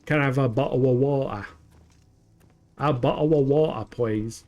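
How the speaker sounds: hammed-up British English, dropped T's in bottle and water; loud mock English glottal-stop accent; expected English accent. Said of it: "You're expecting".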